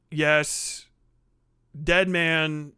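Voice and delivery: ordinary talk, nothing marked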